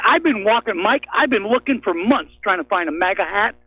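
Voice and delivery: usual speaking voice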